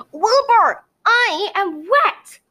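high, loud complaint; slow, outraged wail; exclaiming. A narrator's reading. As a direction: unicorn protesting about being wet. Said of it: "Gemma exclaimed".

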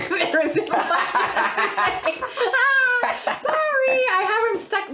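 laughing through the words. Real, not acted. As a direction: giggle while saying it. Laughing while answering.

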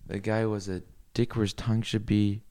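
ordinary delivery

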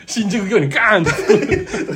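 slow, laugh-filled punchline. Real, not acted. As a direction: giggle out the punchline. Laughing while finishing the story.

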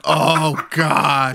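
high, loud "oh God" moan; mock moan. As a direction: moan it like a climax. Acted moaning.

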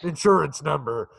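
put-on phone scammer voice, pitch climbing; mock-official demand for money; mock scam caller. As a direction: play a hammed-up tax scam caller.

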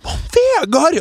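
high, loud exasperated protest; scolding cry. Retold or imitated. Mother and sister protesting his coffin talk, loud. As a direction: cry out his name in mock dismay.

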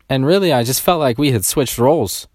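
ordinary talk, nothing marked

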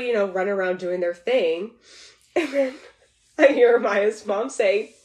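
normal talking voice

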